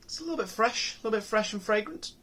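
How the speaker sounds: an unplanned squeak up in pitch while describing the smell; voice went high. Real, not acted. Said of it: "my voice went so high there".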